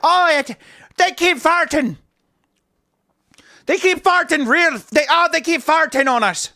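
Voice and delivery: high, slow, put-on Irish accent. Irish people complaining about the farts, in a mock Irish accent. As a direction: squeal it in a hammed-up Irish accent. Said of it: "is that a good Irish accent?".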